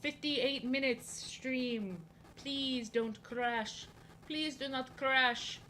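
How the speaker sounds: drawn-out begging, very slow; stretched plea to a tablet; slow pleading. Pretend. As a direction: draw it out, begging the iPad.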